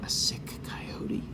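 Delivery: whispered aside. Shown as a demonstration. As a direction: whisper to the listeners about the sick coyote. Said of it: "I'll change the voice".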